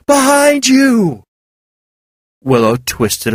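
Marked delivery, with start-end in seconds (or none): 0.1-1.2 yell. A narrator's reading. Val warning Willow of the beast, urgent yell. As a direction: cry out the warning. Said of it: "Val yelled".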